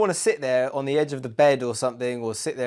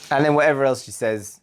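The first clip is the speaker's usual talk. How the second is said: ordinary delivery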